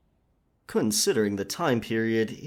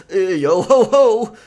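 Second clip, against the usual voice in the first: higher, louder swaggering pirate voice, slow on the wares; over-the-top pirate accent; hammed-up pirate speak; 6 semitones higher, 9 dB louder than usual. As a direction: play a bad stage pirate to fool the merchant. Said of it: "the pirate speak".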